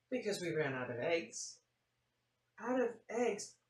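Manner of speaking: lively storybook read-aloud; animated picture-book reading; reading to children. A narrator's reading. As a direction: tell it like story time for kids.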